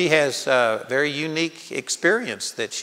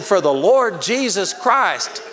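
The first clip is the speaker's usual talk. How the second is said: slow, higher, louder proclamation of faith; emphatic declaration; 6 semitones higher, 4 dB louder, slower than usual. Retold or imitated. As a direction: lean on each word proudly, slowly. Proud answer in retold scene.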